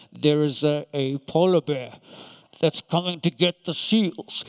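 low, loud documentary narrator voice; Attenborough impression. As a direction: boom it out like a nature documentary, slowly. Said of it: "Richard Attenborough".

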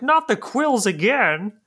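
high, slow whiny scientist. Acted. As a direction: squeal it out slowly like a frantic scientist. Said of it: "He's like".

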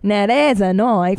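slow mock Australian accent; Crocodile Dundee. Co-host mocking comic's British accent, slow Australian movie-hero accent. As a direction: draw it out in an imitated Australian accent. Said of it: "Crocodile Dundee".

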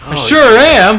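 loud, high cheesy customer voice; eager ad customer. Acted. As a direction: gush like a TV ad buyer. Ad customer reply.

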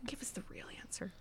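whisper. Real, not acted. Teasing aside to friend.

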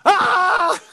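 high, loud cut-in scream; mock scream. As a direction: scream as if grabbed mid-search. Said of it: "Hear a scream".

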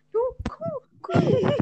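very high, soft cooing; hushed high-pitched call, breaking into a laugh; imitated cooing call. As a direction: coo softly in a high little voice. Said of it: "and I literally was like".

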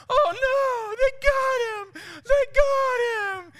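very high, loud falsetto cry; drawn-out mock lament; imitated wailing tourists. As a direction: wail slowly like horrified onlookers. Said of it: "you can hear the people".

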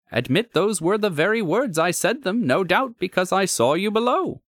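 animated gentleman visitor justifying himself; conceding visitor. Acted. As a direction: concede briskly, pitched well up.

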